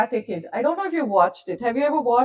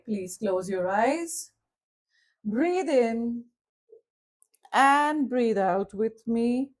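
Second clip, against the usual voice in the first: slow guided breathing, long pauses; guided visualization voice, slow on the breaths; guided meditation. Acted. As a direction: lead them gently through the breath. Leads a visualization.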